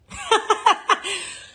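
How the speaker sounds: high burst of laughter; big laugh. Real, not acted. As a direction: crack up laughing. Laughs at own joke.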